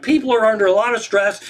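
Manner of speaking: high-pitched amazed echo; incredulous retelling. Retold or imitated. Comic retells how he explained away a shootout, incredulous. Incredulous repeat of calm excuse.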